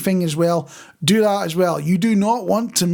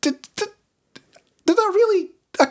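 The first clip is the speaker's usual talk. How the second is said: much higher, incredulous inner voice with a laugh; stunned disbelief; 13 semitones higher than usual. Retold or imitated. Recalling watching a Botox injection, in stunned disbelief. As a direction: replay your own shock at what you just saw. Retold disbelief at strange sight.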